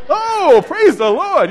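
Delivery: hammed-up church lady cry; church lady impression. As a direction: shout it like a Holy Ghost sister. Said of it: "she'd do her".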